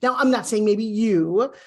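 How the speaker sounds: usual speaking voice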